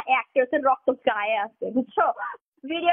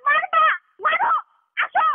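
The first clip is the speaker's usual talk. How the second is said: a loud, much higher yell daring him to hit her; yelling dare; 14 semitones higher, 4 dB louder, slower than usual. Real, not acted. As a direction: shout the challenge in fury. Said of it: "তুমি চিল্লাইতেছো কেন?". (রাগে চিৎকার করে challenge)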